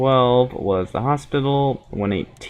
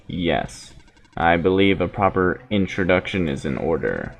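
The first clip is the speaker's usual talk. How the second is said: low, measured android speaking evenly; steady, solemn machine prophet; calm robot voice; 6 semitones lower, 3 dB quieter than usual. Acted.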